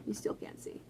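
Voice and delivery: whisper. Real, not acted. Aside to camera.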